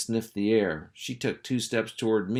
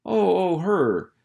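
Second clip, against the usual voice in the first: high, louder oh, oh; sudden recall; 10 semitones higher, 6 dB louder, slower than usual. Acted. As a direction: caught out, remembering. Henry suddenly remembers Mrs Gregg.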